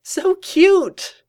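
very high, loud cartoon delight; gleeful squeal. A narrator's reading. Pinkie charmed by the bickering pair, gleeful squeal. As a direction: squeal it with sheer glee. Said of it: "Pinkie Pie smiling and laughing with glee".

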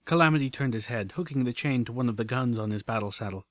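ordinary delivery, nothing marked

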